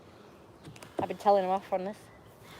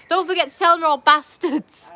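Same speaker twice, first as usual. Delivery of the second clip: calling out; 4 semitones higher, 7 dB louder than usual. Real, not acted. Calling out to mum.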